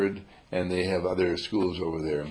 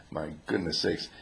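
normal talking voice

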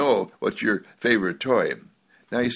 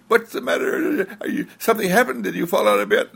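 high, fast, breathless worried parent; 11 semitones higher, faster than usual. Retold or imitated. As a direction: rush in fussing over the baby. Parent rushing to crying baby.

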